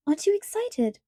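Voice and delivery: put-on high, eager girlish question; bubbling over with excitement; excited. A narrator's reading. Elizabeth asking her friend, eager and excited.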